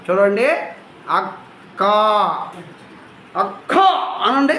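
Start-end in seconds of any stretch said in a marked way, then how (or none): 1.1-3.8 అక్క split by a held stop, then a strong క; pressed double consonant. Shown as a demonstration. Teacher models for pupils to repeat, అక్క with pressed double క. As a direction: hold the stop in అక్క, then push out the second syllable. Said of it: "ఒత్తి మరీ పలకాలి".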